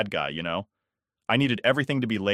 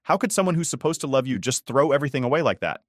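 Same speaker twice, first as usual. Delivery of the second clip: usual speaking voice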